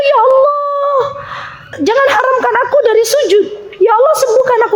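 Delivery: high, crying plea to God, voice breaking; tearful prayer. Retold or imitated. Retelling her teacher's weeping prayer to be able to prostrate again. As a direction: beg through tears like the weeping teacher. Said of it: "Jadi beliau tuh nangis".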